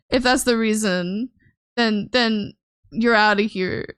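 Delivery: usual speaking voice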